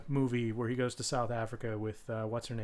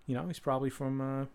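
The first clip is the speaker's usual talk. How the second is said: softer, offhand Boston local; Boston accent; 6 dB quieter than usual. Acted. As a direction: toss it off like someone from Boston. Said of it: "someone from Boston would be like".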